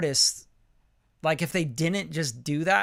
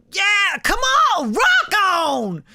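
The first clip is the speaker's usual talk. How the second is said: high, loud, slow hyped yell; stretched-out rock ad lib shout; 7 dB louder, much slower than usual. Shown as a demonstration. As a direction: shout it like a frontman. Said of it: "give us an idea".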